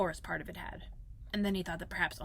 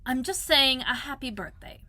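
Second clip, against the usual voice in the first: airy, offhand Owl, a little raised; careless; 4 semitones higher, 6 dB louder than usual. A narrator's reading. Owl reads out his writing to Pooh, carelessly. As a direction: toss it off as nothing much. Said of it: "said Owl carelessly".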